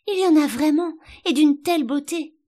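high, loud rapture; delighted exclamation. A narrator's reading. Alice marvels at the rushes' beauty, enraptured. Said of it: "dans un transport de délices".

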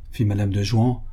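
ordinary delivery

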